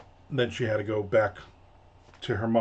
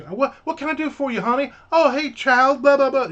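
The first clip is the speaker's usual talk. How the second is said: very high-pitched mock-sweet waitress; gushing waitress; 12 semitones higher, 5 dB louder than usual. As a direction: fuss over customers in a high, loud parody. Imitates waitress gushing over customer, kids.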